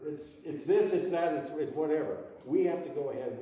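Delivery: announcer shout easing off. Shown as a demonstration. Teacher still calling out to the student, fading. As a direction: keep calling it out, then settle. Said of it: "like a blue light special at Kmart".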